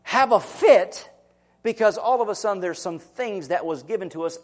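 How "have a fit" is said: slow, louder "have a fit"; emphatic. Real, not acted. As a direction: stretch out the tantrum. Mocking throwing a tantrum.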